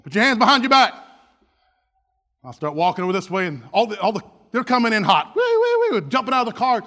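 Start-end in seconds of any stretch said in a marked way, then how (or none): 0.0-0.9 loud, raised arresting order; police command. Acted. Officer to surrendering fugitive, loud command. 5.3-6.1 high wailing "wee" siren noise; imitated siren. Cop cars coming in hot, imitated siren.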